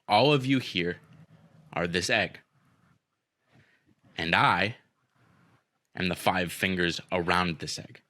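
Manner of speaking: slow, deliberate threat with long pauses; measured retold ultimatum; acted menacing speech. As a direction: play the intimidating boss, letting each phrase hang. Retelling a boss's menacing speech.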